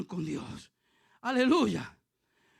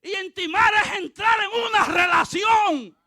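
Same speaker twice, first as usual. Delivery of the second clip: loud, raised-pitch pulpit delivery; full-force preaching; 7 semitones higher, 9 dB louder than usual. Real, not acted. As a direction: preach it out to the whole congregation.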